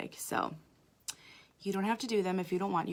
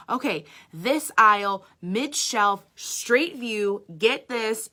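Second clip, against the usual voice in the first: louder, deliberate step-by-step orders; very direct instructions; 3 semitones higher, 7 dB louder, much slower than usual. Retold or imitated. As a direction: spell it out for him like a drill. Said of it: "it has to be so direct".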